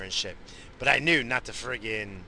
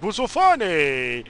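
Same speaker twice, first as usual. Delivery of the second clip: Bobo's voice; 6 semitones higher, 5 dB louder, slower than usual. Retold or imitated. Bobo walks in.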